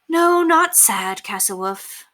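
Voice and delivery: slow, drawn-out gentle comfort; reassuring. A narrator's reading. Governess soothing a worried child, slow and tender. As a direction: soothe the little girl.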